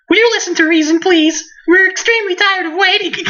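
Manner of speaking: put-on very high-pitched little-girl character voice; loli voice. Little girl tired of waiting demands reason, loli voice. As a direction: squeak it out as an impatient anime loli. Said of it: "Okay, lolly voice".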